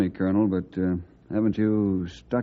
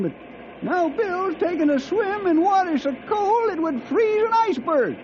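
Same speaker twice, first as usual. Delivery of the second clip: put-on high old cowhand voice, puzzled grumble; grumbling old sidekick. Sidekick grumbling to friends about Bill's cold swim, high and puzzled. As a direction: grouse in a high old-timer voice.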